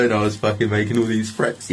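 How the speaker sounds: usual speaking voice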